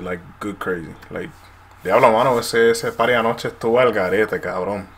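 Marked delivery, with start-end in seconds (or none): 1.8-4.9 loud, excited Puerto Rican Spanish; Puerto Rican accent. Shown as a demonstration. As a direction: hype Puerto Rican street talk.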